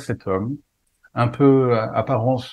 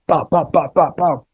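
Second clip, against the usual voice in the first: punching sound effect; 5 semitones higher, slower than usual. Retold or imitated. Chips bag hitting him.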